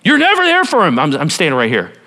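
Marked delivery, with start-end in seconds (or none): 0.0-1.0 higher, whining complaint; mock-whiny Gideon; whiny accusation. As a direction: pout it at God. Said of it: "He's telling God he's never there".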